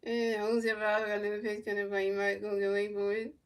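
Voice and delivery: lightly voiced broadcaster calling the at-bat; mock play-by-play. Fan calling the game live to stream viewers, mock broadcaster. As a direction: narrate each pitch like a TV booth.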